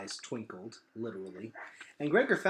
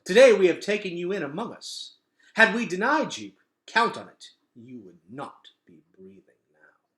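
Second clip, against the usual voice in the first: stern warning; 2 semitones higher, 10 dB louder than usual. A narrator's reading. Vicus continues stern warning.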